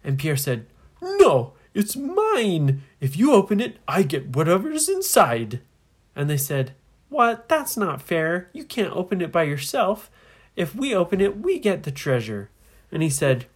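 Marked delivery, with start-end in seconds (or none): 1.0-5.6 high, loud protest; hammed-up indignant squawk; possessive outburst. Captain quarrels over the treasure. 7.1-12.5 loud, raised-pitch objection; loud cry of unfairness; indignant protest. Acted. Children protest the unfair claim.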